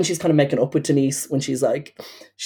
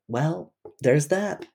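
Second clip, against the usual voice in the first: mock deadpan; 2 semitones higher, 4 dB quieter, much slower than usual. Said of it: "when she's like".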